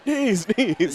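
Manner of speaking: mock corner shout, pitched high; imitated yell. Commentator imitating the other corner, high mock yell. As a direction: call it out high without real volume. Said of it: "you hear his, her corner yelling".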